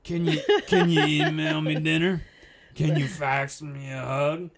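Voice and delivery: put-on slow, rambling drunk uncle. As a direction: do the slurry SNL uncle bit. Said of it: "Drunk Uncle from S N L".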